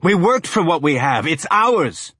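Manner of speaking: high, loud indignant yelling; furious owner defending his cargo; angry shout. Acted.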